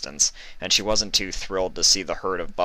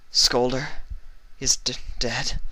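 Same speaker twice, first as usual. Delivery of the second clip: starting to cry; 6 semitones higher than usual. A narrator's reading. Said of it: "beginning to cry".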